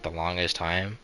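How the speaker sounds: usual speaking voice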